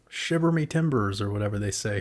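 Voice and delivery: ordinary talk, nothing marked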